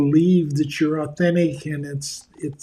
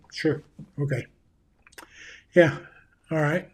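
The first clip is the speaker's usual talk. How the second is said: mock offhand "sure, okay, yeah, yeah"; dismissive brush-off. Shown as a demonstration. Leader showing an employee he isn't really listening. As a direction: toss it off as if you're not listening. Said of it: "You see the difference?".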